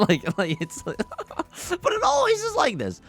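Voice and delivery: high-pitched laughing through the words. Real, not acted. Laughing at own haircut vanity with the viewers, laughing. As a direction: giggle it out, slow and high.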